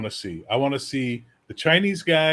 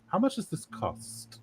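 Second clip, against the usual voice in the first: sensible Dane; 6 semitones higher than usual. Retold or imitated. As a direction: poke fun at a cautious, sensible Dane. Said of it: "Danes are a sensible person culture".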